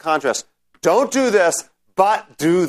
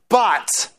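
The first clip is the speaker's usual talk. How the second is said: "but" said higher and set apart; a pointed, raised "but"; emphatic but; 4 semitones higher than usual. Shown as a demonstration. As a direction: hit "but" as a strong turn. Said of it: "so here's the negative".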